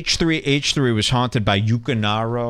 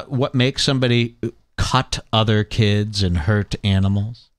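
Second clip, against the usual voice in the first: ordinary delivery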